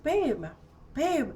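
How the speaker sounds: mock-plaintive high call with a falling tail; acted calling to partner.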